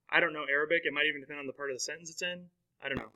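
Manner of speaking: ordinary talk, nothing marked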